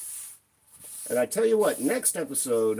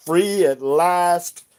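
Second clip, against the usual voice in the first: triumphant proclamation; 5 semitones higher, 11 dB louder, much slower than usual. Pretend. Triumphant cry after lifting axe.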